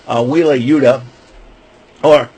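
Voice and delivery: ordinary delivery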